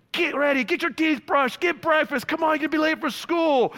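put-on high-pitched parent rounding up the kids; mock morning-rush parent; hurrying parent. Acted parent hurrying kids to school.

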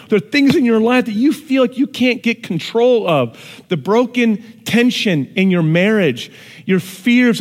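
loud, high preaching voice; fervent pulpit preaching. Real, not acted. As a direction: preach it out with fervour. Preaching at full force.

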